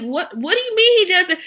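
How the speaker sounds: high disbelieving question; incredulous. Real, not acted. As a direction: sound shocked and puzzled. Incredulous reaction.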